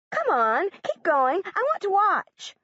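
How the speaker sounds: high, eager schoolgirl voice; excited little girl asking; eager young girl. A narrator's reading. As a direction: gush like an impressed classmate. Emma pleads to watch.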